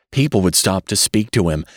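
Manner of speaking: plain reading voice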